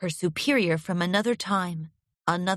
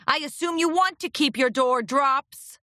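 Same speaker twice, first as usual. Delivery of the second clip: gruff coughing agent; 6 semitones higher than usual. Acted. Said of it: "Furlong coughed".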